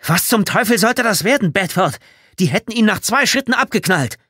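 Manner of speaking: high, loud furious outburst; angry confrontation. A narrator's reading. Tommy rounding on Bedford over the near shooting, furious shouting. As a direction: shout an outraged accusation.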